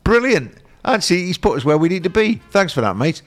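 swans voiced high and chirpy; swans thanking him. Swans set down by the canal thank the handler: high cheery voice.

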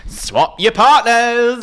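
podcaster mocks the show's partner swap, high drawn-out hype; hyped show announcer. Stretch it out like an over-excited TV host. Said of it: "would have been better had they have said".

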